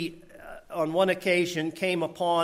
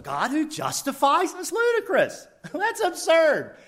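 high, scoffing disbelief; pitched way up in disbelief; mock incredulity; 12 semitones higher, 5 dB louder than usual. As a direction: laugh off the absurd idea. Mocking an absurd accusation.

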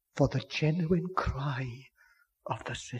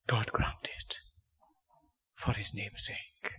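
soft, lower whispered blessing; whispered prayer; 6 semitones lower, 8 dB quieter than usual. Acted. Preacher closing the appeal in prayer, whispered.

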